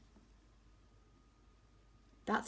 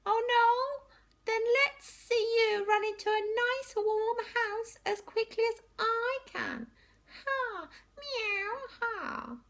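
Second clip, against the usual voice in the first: taunting cat; 7 dB louder than usual. Acted.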